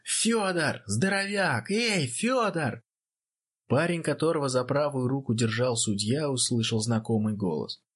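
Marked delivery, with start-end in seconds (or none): 0.0-2.8 high calls stretched across the hall; shouting over crowd. Acted. Cadets hailing the boxer over the crowd, shouting high. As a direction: call out to a friend in the ring. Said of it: "прорывались к рингу и кричали".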